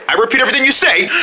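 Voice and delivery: quick parrot patter; fast patter. Acted. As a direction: rattle it off quickly.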